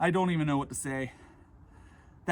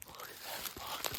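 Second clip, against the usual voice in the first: slow awed whisper; hushed admiration; 16 dB quieter, slower than usual. Real, not acted.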